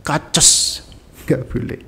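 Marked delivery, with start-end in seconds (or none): 0.0-0.8 "Kaces" with ch and s hissed together, long; exaggerated ch-s hiss. Shown as a demonstration. Teacher mocks the wrong reading, hugely hissed "Kaces". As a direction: overdo the hiss at the end of "Kaces". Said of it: "digabungin C sama S".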